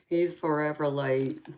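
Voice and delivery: giggling through the words, pitch up; laughing. Real, not acted. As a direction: laugh out the teasing line. Teasing a late viewer on stream.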